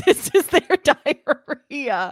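slow, loud, drawn-out echo; mocking echo. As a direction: stretch each word in amused disbelief. Echoes co-host's odd phrase mockingly.

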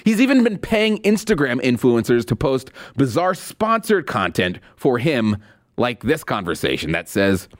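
normal talking voice

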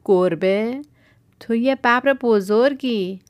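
man astonished a tiger calls itself a cat; surprised. A narrator's reading. Man startled at the tiger's claim, loud disbelief. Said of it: "مرد با تعجب پرسید".

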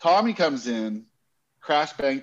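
ordinary delivery

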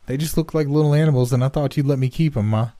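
put-on a scared boy blurting excuses all in one rush; terrified boy pleading. A narrator's reading. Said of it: "speaking the sheer momentum of terror".

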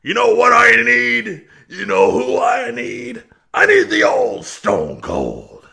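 loud pleading yell, slowing down; desperate shout. Pretend. Vince pleading loudly for the old Stone Cold back. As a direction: shout it like a begging wrestling boss. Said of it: "We need Vince to come out and say".

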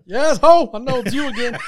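high, loud hammed-up accusation; mock accusing shout. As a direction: call out the culprit, playfully outraged. Acts out catching sneaky school kid.

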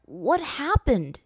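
high, very slow, drawn-out wonder; wide-eyed asking. A narrator's reading. Aang asking Roku in the spirit world, slow and wide-eyed. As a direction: ask it stunned and slow. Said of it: "Aang asked Roku with wide eyes".

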